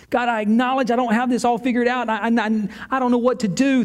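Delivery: high, hurried, stumbling confession; pleading prayer. Acted. Believer confessing to God it's not figured out, pleading. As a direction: admit it urgently, pitch held up. Acted prayer admitting confusion.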